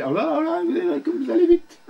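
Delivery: high, startled oh là là; mock alarm. Real, not acted. Teacher to pupil rushing the exercise, high startled exclaim. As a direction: squeal in surprise at the rush.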